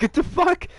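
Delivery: very high, loud disbelief; incredulous. Real, not acted. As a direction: shriek it in disbelief. Incredulous outburst.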